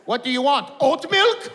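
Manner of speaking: put-on high, loud Arabic-accented jeer; mock the customer in an Egyptian accent; Egyptian shopkeeper.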